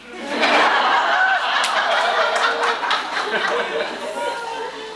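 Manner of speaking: long loud high burst of laughter; a helpless real laugh; real laughing fit. Real, not acted. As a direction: break down laughing mid-reading. Reader breaks into laughter.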